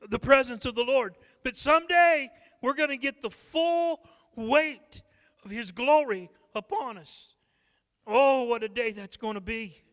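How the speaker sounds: a preacher stirring his church about the glory to come; fervent preaching. Acted. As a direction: preach it out with rising fire. Preaching builds in intensity.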